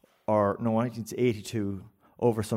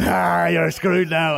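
slow, louder, mocking drawl; gloating tease; 6 semitones higher, 8 dB louder, much slower than usual. Iraqi barber teasing his scared friend, slow gloating mock. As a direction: savour his friend's doom. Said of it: "Iraqi guy's, like, going".

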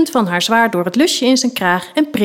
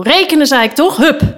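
higher-pitched, insistent order; impatient command; 6 semitones higher, 4 dB louder than usual. Acted. Knight slapping the table at dawdling pupils, impatient command. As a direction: cut off objections and drive them on.

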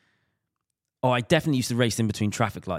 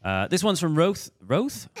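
higher, louder radio DJ read; 8 semitones higher, 4 dB louder than usual. Acted. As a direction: put on a lightly hammed DJ delivery. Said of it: "work on my radio D J voice".

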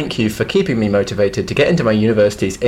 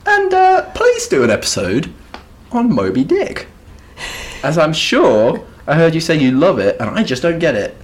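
higher, light woman's voice; 7 semitones higher than usual. Retold or imitated. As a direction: read the letter as a woman. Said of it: "I'm doing a bit of acting".

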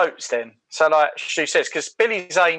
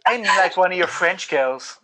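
mock sexy voice; 3 semitones higher than usual. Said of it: "some sort of sexy voice".

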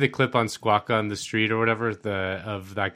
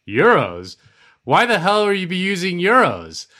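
mock incredulous; 7 semitones higher, 5 dB louder than usual. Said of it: "he goes".

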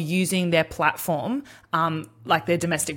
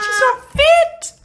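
very high, slow, loud mock outrage; pompous critic; 10 dB louder, much slower than usual. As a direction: stretch it out like an outraged pundit. Echoing parody, drawn out.

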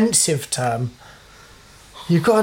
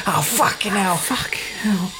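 hushed, voiceless mock swearing; a hint of a neighbour cursing under his breath; lightly voiced neighbour; 5 dB quieter than usual. Mimicking the tile cutter heard over the fence, hushed mock cursing. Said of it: "Why did you say it like that?".

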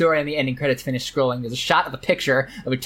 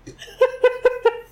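short high giggle; a quick squeaky laugh. Real, not acted. Host amused by the tonkatsu story, giggling.

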